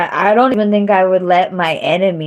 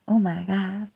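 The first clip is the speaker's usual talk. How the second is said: ordinary talk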